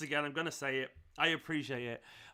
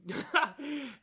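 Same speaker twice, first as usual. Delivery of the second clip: short laugh; 10 semitones higher, 5 dB quieter than usual. Real, not acted. Laughing after a new member joins.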